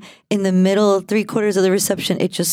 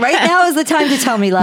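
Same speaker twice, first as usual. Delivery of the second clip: laughing; 7 semitones higher than usual. Retold or imitated. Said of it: "I'm like".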